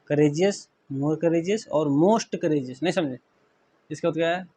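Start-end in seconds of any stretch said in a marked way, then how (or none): none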